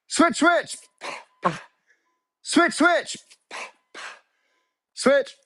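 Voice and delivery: high, punchy coach cue; called-out cadence. Real, not acted. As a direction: call each switch to keep the class on beat. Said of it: "When I say switch, you switch".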